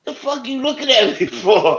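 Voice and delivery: high-pitched mock outrage at a dog; exasperated, high, comic protest; retold indignation. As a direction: challenge a staring pet, half laughing. Scolds staring dog in retelling.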